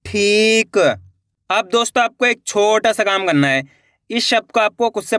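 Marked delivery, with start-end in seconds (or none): 0.1-0.8 ठीक joined as one word with long ई; blended word. Shown as a demonstration. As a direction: read ठीक whole, stretching the ठी. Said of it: "खींच के पढ़ना है".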